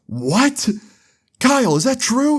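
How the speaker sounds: high-pitched disbelieving question; imitated shocked mom. Mom hearing her son peed in the bottle, imitated high shock. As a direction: voice shoots up in mock shock.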